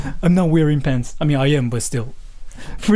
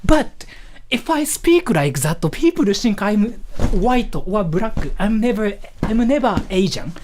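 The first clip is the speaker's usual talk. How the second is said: pitched-up Japanese-accented English; high-pitched Japanese accent; 3 semitones higher than usual. Shown as a demonstration. Streamer showing the voice he has to use, high-pitched Japanese accent. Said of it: "with this accent".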